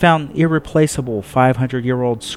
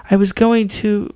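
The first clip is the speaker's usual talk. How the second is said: higher, gentle courteous woman; polite Pope; 11 semitones higher than usual. Acted. Pope offers her visitor a seat, courteous. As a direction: offer the chair kindly.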